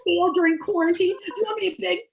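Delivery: hysterical rant. Acted. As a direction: spiral into self-pity. Son's tearful rant.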